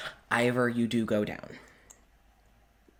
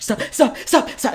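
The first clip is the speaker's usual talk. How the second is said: high, loud frantic cry; panicked stop; 10 dB louder than usual. Acted. Wallace recoiling from Larkin's magic, a frantic shout. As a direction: shriek it to push him away.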